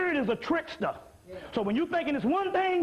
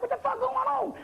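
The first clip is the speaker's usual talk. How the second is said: high, startled cry of disbelief; high-pitched mock alarm; shocked disbelief; 11 semitones higher than usual. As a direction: squeal it like you just saw your own gut. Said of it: "I'm like".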